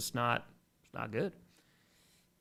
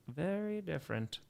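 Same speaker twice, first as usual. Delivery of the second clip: knowing aside; 3 semitones higher, 9 dB quieter than usual. Real, not acted. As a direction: repeat it softly, as a quiet afterthought. Softer echo of the phrase.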